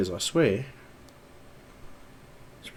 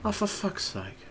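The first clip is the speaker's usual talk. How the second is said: usual speaking voice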